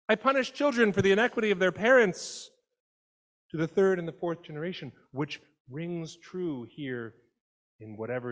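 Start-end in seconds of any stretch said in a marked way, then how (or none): 0.1-4.9 higher, louder and fast, a stern divine warning; stern God. Retold or imitated. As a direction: rattle off God's threat. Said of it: "God tells us who God is".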